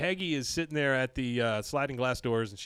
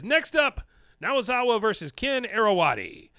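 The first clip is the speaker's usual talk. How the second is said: much higher, louder ring-announcer call; 8 semitones higher, 5 dB louder than usual. Retold or imitated. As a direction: announce the next bout to the crowd. Ref announcing the match.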